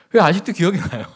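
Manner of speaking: ordinary talk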